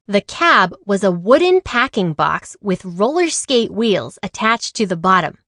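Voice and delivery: ordinary delivery, nothing marked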